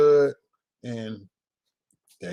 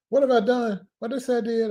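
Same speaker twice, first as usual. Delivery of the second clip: mock innocence; 10 semitones higher than usual. Joking about being accused.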